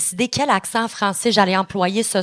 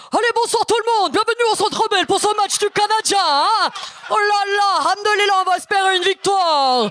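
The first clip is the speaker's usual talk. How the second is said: high, hammed-up Moroccan accent hype; Moroccan-accented arena announcer; 9 semitones higher than usual. Comic welcoming hockey crowd, mock Moroccan announcer. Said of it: "ton accent québécois alors que t'es d'origine marocaine".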